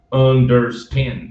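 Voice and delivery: understand with the final D clearly said; full D sound. Shown as a demonstration. Teacher models understand with its D sounded. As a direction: say understand slowly, voicing the D at the end. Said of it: "Quando nós temos um N D".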